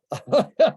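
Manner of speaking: high burst of a laugh; quick bright chuckle. Real, not acted. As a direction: laugh at your own story. Laughing at own retold answer.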